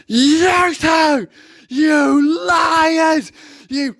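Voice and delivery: high, loud mock-angry shouting; mock the livid copper's rant; hammed-up outraged yell; imitating furious copper. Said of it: "So he goes".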